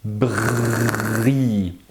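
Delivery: "Bri" slowly, dwelling on a rolling r; drawn-out r. Shown as a demonstration. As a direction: make the r in "Bri" unmistakable. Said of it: "Celui-là, il est plus facile à entendre".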